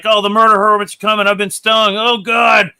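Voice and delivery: loud, high, fast mock panic; hammed-up frantic cry while fleeing; panicked. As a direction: act out a character in full panic. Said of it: "You're panicking".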